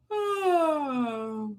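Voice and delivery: a long breathy yawn; yawning gasp. Real, not acted. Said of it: "Everybody start yawning".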